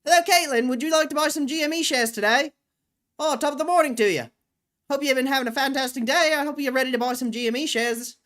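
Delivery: high, loud, rapid newsboy patter. Pretend. Newsboy pitching shares to Caitlin, high hawking shout. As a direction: hawk it like an eager newsboy. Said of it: "Just so I can talk in that voice".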